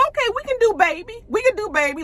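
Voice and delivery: put-on high-pitched baby-soft voice. Shown as a demonstration. Host trying out Pam's voice, high baby voice. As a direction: put on a whiny little baby voice. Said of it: "like, a baby soft voice".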